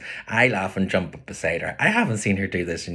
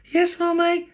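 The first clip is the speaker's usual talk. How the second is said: put-on high, louder child answering Mummy; little boy's voice; 6 dB louder than usual. A narrator's reading. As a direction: pipe up like an obedient little boy. Child answering his mother.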